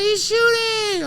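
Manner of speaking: very high, loud, slow panicked yell; panicked shout. Retold or imitated. People in the bar warning him, panicked high yell. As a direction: cry out the warning in alarm. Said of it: "They're like".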